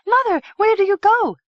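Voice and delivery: high, loud anxious cry after mother; distressed call. A narrator's reading. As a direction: call out to her in alarm. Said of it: "called out in distress".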